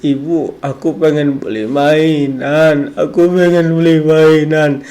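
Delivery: mock child crying for a toy, slow and whiny; crying child whine. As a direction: wail it like a small kid begging for a toy. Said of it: "mungkin dia menangis".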